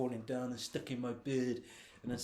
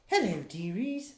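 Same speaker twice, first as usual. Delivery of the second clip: put-on slow, higher old-lady croon; Missus Hayward voice; 7 semitones higher, slower than usual. Man finally landing the old lady voice, slow and higher. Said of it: "There we go. That's better".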